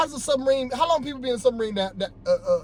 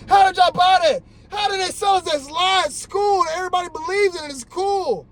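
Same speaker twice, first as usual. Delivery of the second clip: heated rant; 8 semitones higher than usual. Real, not acted. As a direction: demand an answer, fed up. Ranting vlogger gets worked up.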